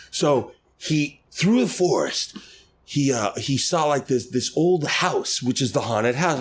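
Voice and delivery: slow, high, drawn-out storyteller voice; tipsy older lady. Retold or imitated. Older lady telling how the park began, slow tipsy voice. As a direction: imitate a tipsy woman spinning a legend. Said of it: "had a couple pops in her".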